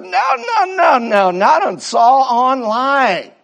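squeaky joking refusal; mock high shout. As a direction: yelp of teasing protest.

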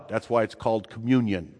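usual speaking voice

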